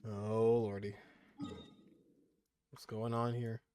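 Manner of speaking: usual speaking voice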